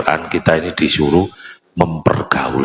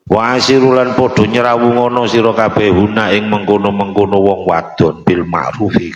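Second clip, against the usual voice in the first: sing-song Javanese translation, higher; traditional kitab gloss; 3 semitones higher than usual. Acted. Kyai glossing the verse for listeners, sing-song. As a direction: chant the gloss like a pesantren teacher.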